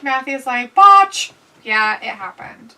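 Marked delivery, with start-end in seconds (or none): 0.7-1.3 loud, high one-word shout; called out. Retold or imitated. Reading Matthew's comment to co-host, shouted out. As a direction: belt it out like a heckle.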